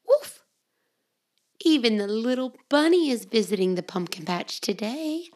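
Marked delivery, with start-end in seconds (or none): 0.1-0.4 high yappy puppy woof; puppy bark. Acted. Reading Biscuit to children, high puppy woof. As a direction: bark it like a little dog. Dog bark voiced in story.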